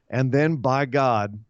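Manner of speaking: ordinary delivery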